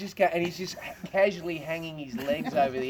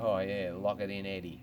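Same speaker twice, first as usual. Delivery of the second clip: low, soft purr of a bloke; demonstrating male purr; 5 semitones lower, 5 dB quieter than usual. Shown as a demonstration. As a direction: purr it low and quietly, trailing down. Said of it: "this is how we purr".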